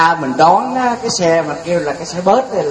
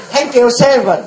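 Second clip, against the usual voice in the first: laughing mock retort; 7 semitones higher, slower than usual. Prime minister keeps escalating numbers.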